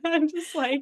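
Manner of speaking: ordinary talk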